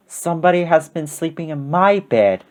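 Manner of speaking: restrained Mama Bear voice; a modest bear voice, gently held back; middle-sized voice. A narrator's reading. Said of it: "in her middle-sized voice".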